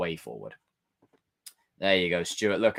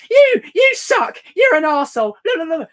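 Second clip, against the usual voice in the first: keyboard warrior impression; 11 dB louder than usual. Imitating a keyboard warrior insulting online, loud and high. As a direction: screech the insults like an online troll. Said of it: "It's someone that does this".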